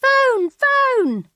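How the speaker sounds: put-on very high, loud child's yell; shrieking little girls, drawn-out calls; children shouting. Girls running into the kitchen, shouting. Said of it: "The girls run into the kitchen shouting".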